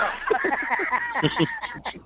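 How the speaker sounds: high-pitched real cackle of laughter; long burst of high laughter; laughing. Real, not acted. Storyteller laughing at his own joke, high laughter.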